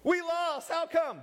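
very high-pitched, whiny protest; acted high-voiced bafflement; mock bewildered complaint. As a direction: squeak it out like a sore loser. Acting out losers questioning God.

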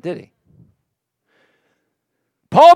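ordinary delivery

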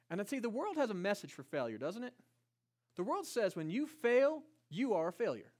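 ordinary delivery

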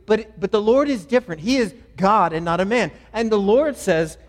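loud, earnest preacher explaining with conviction; forceful preaching. Real, not acted.

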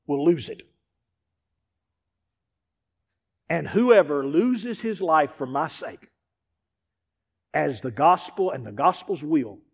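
slow, low, fading reading of the words; grave scripture read sinking to a hush; solemn scripture reading. Acted. Reads Mark as Jesus.

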